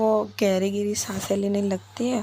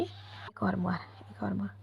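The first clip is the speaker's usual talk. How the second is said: much softer, hushed to herself; nervous self-talk; 10 dB quieter than usual. Acted. Viya psyches herself up, softly under her breath. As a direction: whisper encouragement under breath.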